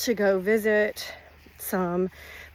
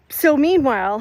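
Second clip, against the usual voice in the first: normal talking voice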